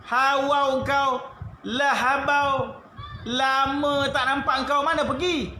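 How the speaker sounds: high, slow, rowdy mock insult; a Malay villager cheerfully cursing a friend; rough village greeting. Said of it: "Macam orang Kelantan lah".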